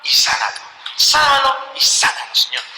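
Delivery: earnest, rising plea to God; pleading prayer. Retold or imitated. Brother praying for his sick brother, pleading. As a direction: beg the Lord to save him. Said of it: "Yo le pedía".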